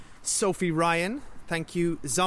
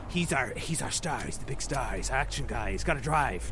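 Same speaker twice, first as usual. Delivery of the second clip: lightly voiced in a lower register; mock filmmaker reasoning; 5 semitones lower, 3 dB quieter than usual. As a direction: drop low and a touch softer, imitating their excuse. Voicing filmmakers' reasoning about the star.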